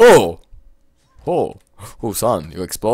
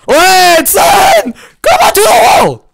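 very high loud frantic yell; panicked shouted orders; 14 dB louder, slower than usual. Real, not acted. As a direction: scream at the character to move. Frantic game moment.

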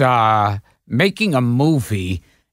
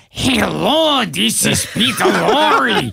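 put-on higher, proper Peter Lorre accent; 6 semitones higher, slower than usual. Director corrects with a real Peter Lorre voice. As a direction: show him how Lorre should sound. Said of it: "And they go, "No, no".

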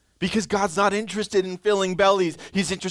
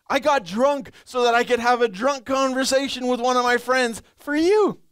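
higher, louder whiny self-justifying believer; pleading excuse-maker; 9 semitones higher, 5 dB louder than usual. Pretend. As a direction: beg God to accept the excuse. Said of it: "How often do we do this as Christians?".

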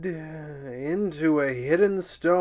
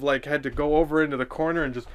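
ordinary delivery

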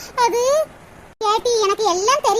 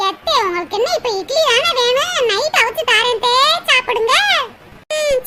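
ordinary delivery

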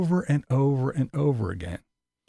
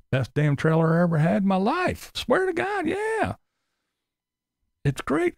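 much higher mock gushing; over-the-top mock praise; mocking Tim; 5 semitones higher than usual. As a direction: parody of him raving about his trailer. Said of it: "Said it was the greatest thing".

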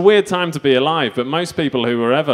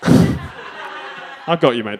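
normal talking voice

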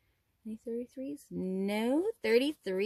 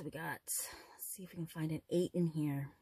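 whispering; 6 semitones lower, 8 dB quieter than usual. Real, not acted. Whispering while searching card.

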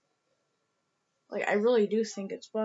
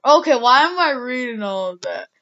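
self-interrupting exasperation; 9 dB louder than usual. Real, not acted. Catches self reading messages aloud.

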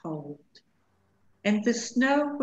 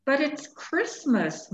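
higher, mildly plaintive moan; 8 semitones higher, 3 dB louder than usual. A narrator's reading. Lonely bunny protests to the wolf, moaning a little. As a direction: lift it into an understated bunny whine. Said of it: "moaned Barrington".

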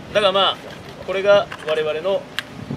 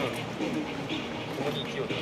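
mumbled, half-hearted line; too quiet; 7 semitones lower, 13 dB quieter, much faster than usual. Shown as a demonstration. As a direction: say it shyly under the breath. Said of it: "ちょっとや、やってみてくれ。".

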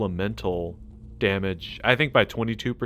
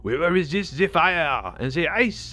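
hammed-up bad French accent; butchered French accent; 3 semitones higher than usual. Gamer reading an item's effect in butchered French accent. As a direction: ham it up as a cartoon Frenchman. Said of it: "I just butchered, uh, the, the French accent".

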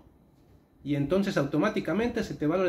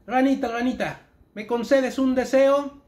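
llama a la rana fuerte y lento; invocación en voz alta; 8 semitones higher, 7 dB louder than usual. Acted. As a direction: recita las palabras mágicas en voz alta y más aguda. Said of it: "vamos a decirle una vez más". (conjuro en voz alta, más agudo y lento)

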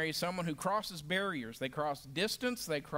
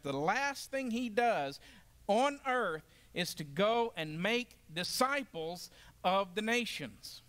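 slow emphatic. Real, not acted. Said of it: "I really want to emphasize this".

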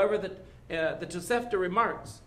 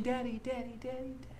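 higher and softer, a hint of a small girl calling Daddy; little daughter calling; 8 semitones higher, 7 dB quieter, faster than usual. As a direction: call softly like a child for her father. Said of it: "voice of a daughter who keeps saying".

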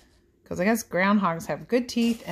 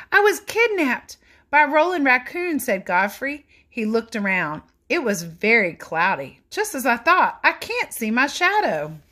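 put-on lively read-aloud for children; animated picture book narration; storybook reading; 6 semitones higher, 5 dB louder than usual. A narrator's reading. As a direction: read it like bedtime story time.

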